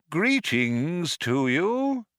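a hint of a reedy, ghostly recording; hollow, reedy voice. A narrator's reading. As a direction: draw the greeting out, thin and hollow. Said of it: "a voice that was equally hollow, reedy, and insubstantial".

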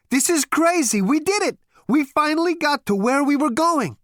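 high, loud jubilant shouting; elated. Acted. Zaphod's jubilant realisation.

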